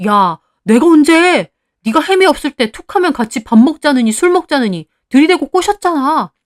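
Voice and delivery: loud, high accusing shout; angry quarrel. A narrator's reading. Husband denies seducing Heeju, shouting in anger. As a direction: throw the blame back at full volume. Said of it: "둘이 대놓고 욕하며 싸우더라고요".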